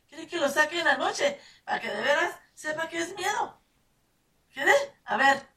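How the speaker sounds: high, loud scolding threat; mother threatening to put the kids outside at night. Retold or imitated. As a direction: challenge them to answer.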